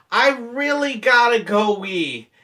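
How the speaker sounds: insistent play-acted begging; mock urgent plea. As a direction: stretch it out like a hammed-up urgent plea. Feigned urgency to manager, first plea.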